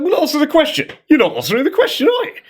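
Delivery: high, loud, insistent challenge; badgering interviewer impression. Impressionist mimicking an interviewer demanding an answer, loud and badgering. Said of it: "it's like".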